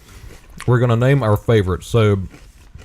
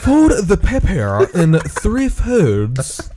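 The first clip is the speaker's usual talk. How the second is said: high, slow mock product-demo voice; knife commercial voice; 7 semitones higher than usual. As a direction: imitate a stilted foreign-accented ad narrator. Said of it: "The Deal Extreme knife commercial".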